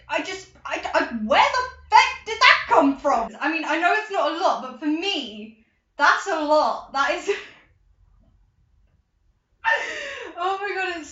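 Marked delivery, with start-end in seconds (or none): 0.9-3.4 amazed. Real, not acted. 9.6-10.3 squealing laugh. Real, not acted. Joyful outburst.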